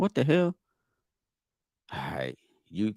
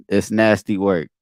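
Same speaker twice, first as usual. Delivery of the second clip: lightly put-on [name] catchphrase, louder; 8 dB louder than usual. Reacting to a battle rap line, a hint of [name]'s voice. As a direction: drop the line the way [name] would. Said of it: "[name] voice".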